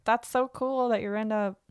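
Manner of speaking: fake enthusiasm. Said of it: "fake about it and be like".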